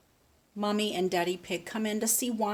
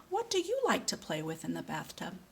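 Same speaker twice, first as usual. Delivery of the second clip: ordinary delivery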